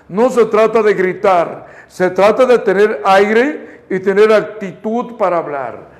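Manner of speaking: ordinary talk, nothing marked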